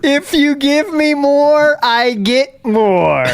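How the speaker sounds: high and very slow, word by word; extra slow, high, patronising. Acted. Repeating the tip logic.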